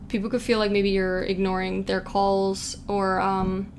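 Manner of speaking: ordinary talk, nothing marked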